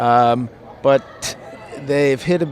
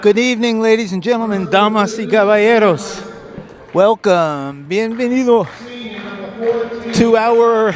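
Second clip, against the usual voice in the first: lucha libre announcer; 5 semitones higher than usual. Acted. Bilingual arena welcome to crowd.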